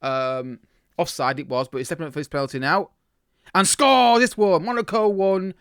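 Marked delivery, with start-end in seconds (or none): 3.5-5.5 excited. Real, not acted. Penalty scored.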